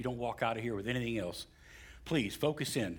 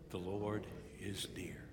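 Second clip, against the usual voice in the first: soft, low-key near whisper; hushed affirmation; 3 semitones lower, 8 dB quieter than usual. Real, not acted. Preacher leading congregation to repeat, hushed affirmation. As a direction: lead them softly in saying it.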